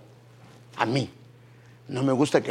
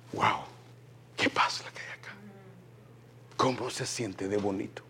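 soft, wondering whisper; hushed awe; 3 semitones lower, 9 dB quieter than usual. Pretend. Visitor entering a holy home, soft awed whisper.

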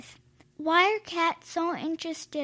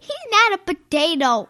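higher, louder indignant protest; 7 semitones higher, 6 dB louder than usual. Real, not acted. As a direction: object loudly. Child protests loudly.

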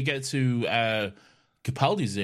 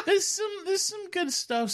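laughing. Real, not acted. Laughing through words.

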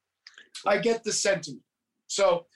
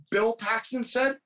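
ordinary talk, nothing marked

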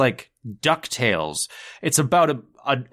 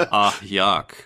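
deadpan, lower "Ah, yuck" with no Goofy voice; flat Goofy catchphrase; 5 semitones lower than usual. Retold or imitated. Bill Farmer reading the catchphrase flatly, deadpan. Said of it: "Bill Farmer is just like".

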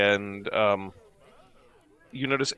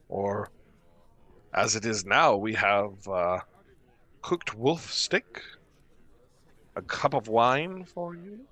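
welcoming innkeeper. Acted. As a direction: greet travelers as a hospitable host. Game master voices the innkeeper.

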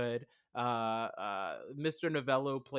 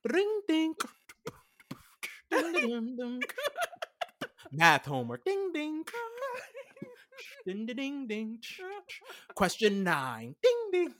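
chime sounds; 14 semitones higher than usual. Pretend. Imitating chill study beats.

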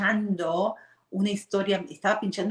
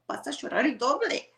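higher mock-mother warning "vas a llorar el doble"; a scolding mom, pitched up, first take; imitating mother; 7 semitones higher than usual. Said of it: "mamá me decía".

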